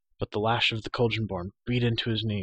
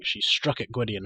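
ordinary delivery

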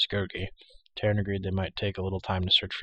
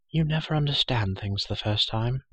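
ordinary delivery

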